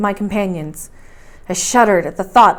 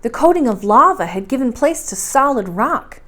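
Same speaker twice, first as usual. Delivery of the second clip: usual speaking voice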